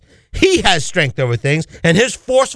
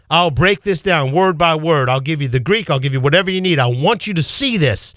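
ordinary delivery

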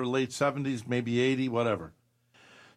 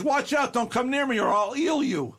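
eel voice; 10 semitones higher than usual. Pretend. The eel voiced warning predators.

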